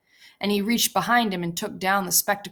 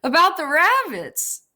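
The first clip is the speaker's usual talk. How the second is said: higher, louder eager burst; almost shouted; 10 semitones higher, 6 dB louder than usual. A narrator's reading. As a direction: blurt it out, bursting with the dream. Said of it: "Lenny almost shouted".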